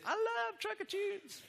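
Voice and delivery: high, drawn-out put-on American accent. Presenter voicing trucker-music fan Roxy, best American accent. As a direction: do a hammed-up American twang, slow and high. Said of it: "that was my best American accent".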